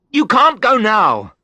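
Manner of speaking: high, loud, slow desperate plea; desperate cry. A narrator's reading. Husband begs the friend not to hang up, desperate cry. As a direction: cry it out, begging her to stay. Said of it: "I cried".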